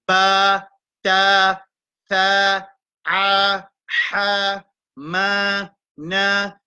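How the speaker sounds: each syllable spelled out clearly; modelled sounds. Shown as a demonstration. Teacher sounding out Arabic letters for the class, clipped and clear. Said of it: "C'est-à-dire le bruit".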